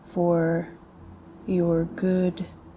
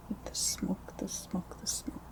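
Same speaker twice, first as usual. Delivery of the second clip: very soft, trailing whisper echo; hushed ASMR echo; whispered repeats; 12 dB quieter than usual. Real, not acted.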